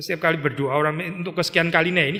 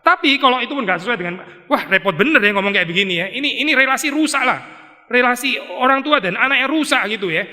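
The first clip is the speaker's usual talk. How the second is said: exasperated outburst; 7 semitones higher than usual. Real, not acted. Exasperated outburst at hedged prayer.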